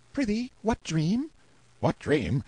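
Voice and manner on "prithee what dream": much higher, youthful voice asking innocently; a high-voiced page boy, puzzled. A narrator's reading. Clarence questions the narrator's dream.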